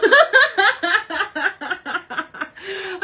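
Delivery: long high-pitched real laugh; laughter. Real, not acted. Vlogger cracking up at "Did Jin send you", laughing. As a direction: crack up helplessly.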